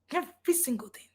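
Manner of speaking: soft, half-whispered emphasis; hushed emphasis. Real, not acted. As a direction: drop to a near whisper for weight. Dramatic hushed emphasis after the laugh.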